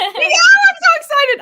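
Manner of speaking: high, loud, slow excited cry; thrilled squeal. Real, not acted. Reader gushing to friends, high and loud. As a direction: let the excitement burst out. Thrilled about the next book.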